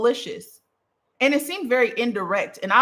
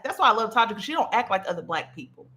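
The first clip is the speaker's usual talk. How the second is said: ordinary talk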